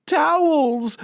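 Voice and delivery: high, slow wail; anguished wail. Acted. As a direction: moan it like a tragedy. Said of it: "she said".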